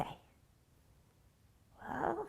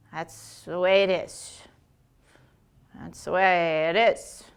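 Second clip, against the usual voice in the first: stern elder; 7 semitones lower than usual. Acted. Stern elder crow lecture.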